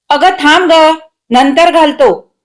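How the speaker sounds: irritated putting-off. A narrator's reading. Student son putting off his mother, irritated. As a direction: fend off the nagging.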